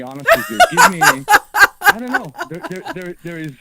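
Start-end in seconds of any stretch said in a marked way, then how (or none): 0.0-2.3 laughing high and loud. Real, not acted. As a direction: talk through a laugh.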